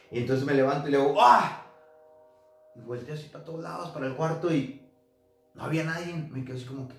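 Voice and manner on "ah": a sudden loud, high yelp of fright; startled cry. Retold or imitated. Waking from the nightmare, a loud startled cry. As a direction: jolt awake with a sharp loud cry.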